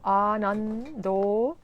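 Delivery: ANANDO slow, with held N and long final O; slow demo of "Anando" with lengthened vowels. Shown as a demonstration. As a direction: stretch out the last vowel of "Anando". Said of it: "is a long vowel".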